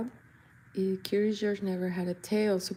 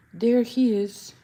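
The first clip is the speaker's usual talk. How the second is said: slow, loud proud announcement; grand reveal; 4 semitones higher, 4 dB louder, slower than usual. Pretend. Presenting the painting, slow grand reveal. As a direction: unveil it like a showman.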